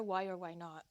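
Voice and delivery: ordinary talk, nothing marked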